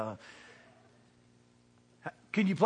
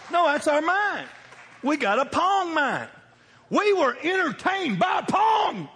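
much higher, louder, slowed shouting; excited shouting; 10 semitones higher, 4 dB louder, slower than usual. Real, not acted. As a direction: build it up into a joyful preaching holler. Excited preaching about Pong.

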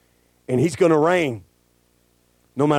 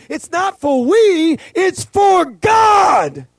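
full-force preaching; 11 semitones higher than usual. Acted. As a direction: preach it out, hammering each line.

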